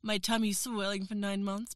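ordinary talk

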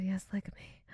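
soft breathy whisper. Acted. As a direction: whisper it close to the ear. Intimate whisper to partner.

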